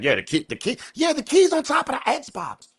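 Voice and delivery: put-on high, falsetto girl voice; squeaky falsetto impression of a girl; mock girl's voice. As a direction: pitch way up to play the girl. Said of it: "She tell the boys, like".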